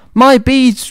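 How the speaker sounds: high, mild mock shriek; high-pitched storybook outcry. A narrator's reading. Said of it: "Drizella shrieked".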